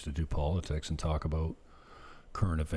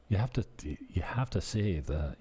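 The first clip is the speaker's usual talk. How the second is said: ordinary talk, nothing marked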